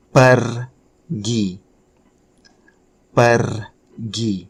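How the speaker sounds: "pergi" with a long trilled R, then a separate "gi"; rolled R. Shown as a demonstration. Teacher introducing a new verb, "pergi" with a drawn-out rolled R. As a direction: roll the R in "pergi" and pause before "gi". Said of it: "challenging to pronounce the R".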